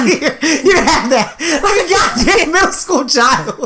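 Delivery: high, drawn-out words through laughter; laughing. Real, not acted. Friend telling co-host about dad's middle-finger trick, laughing hard. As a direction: crack up while telling it. Cracking up retelling dad's prank.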